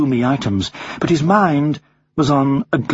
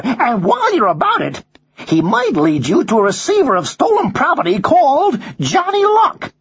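inform on the crook like an American hoodlum bird; high-pitched American wise guy; American accent; 10 semitones higher than usual. Acted. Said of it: "you have an American accent".

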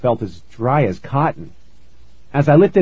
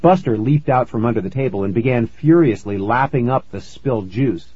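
ordinary delivery, nothing marked

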